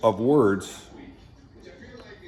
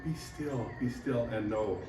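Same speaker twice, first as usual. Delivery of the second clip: soft, pitying coo of a coddling God; mock tender God; 7 dB quieter than usual. Coddling God soothing whiny children, mock soft and pitying. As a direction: hush them like poor children.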